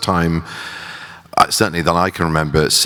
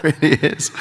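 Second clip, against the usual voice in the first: laughing it out higher; 11 semitones higher than usual. Real, not acted. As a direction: chuckle through the words. Laughing at the huge cast.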